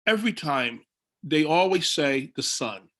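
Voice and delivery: usual speaking voice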